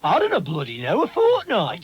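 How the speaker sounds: fortnight jumps high in gruff scorn; rude taxi man. Retold or imitated. Rude Stoke taxi driver to a caller, with a high scornful fortnight. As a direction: bark it back at the customer. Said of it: "And he said".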